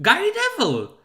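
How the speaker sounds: high, loud correction; high loud correction of who said it; exclaimed name. Real, not acted. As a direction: call out the name. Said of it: "correcting who said it".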